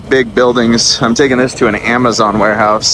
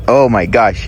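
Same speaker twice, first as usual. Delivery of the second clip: ordinary talk, nothing marked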